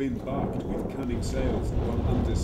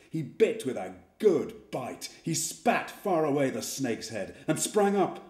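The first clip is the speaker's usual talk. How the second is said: ordinary delivery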